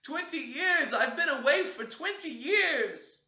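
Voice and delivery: put-on high, slow, grieving child's voice; mourning ghost. Ghost child mourning at the window, high slow lament. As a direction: lament the long exile.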